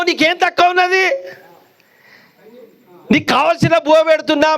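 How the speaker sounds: a puzzled son listing all he gives her; pleading son. Acted. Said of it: "son questions his crying mother".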